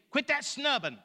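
high, mock-stern order; scolding parent. Parent orders a crying child to stop, scolding parent.

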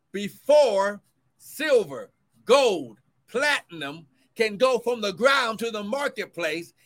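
rising, ringing preacher's reading, slowed on the mark; preaching scripture. Acted. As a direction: proclaim the verse to the congregation. Preacher reads verse.

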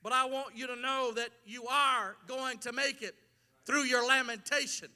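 ordinary talk, nothing marked

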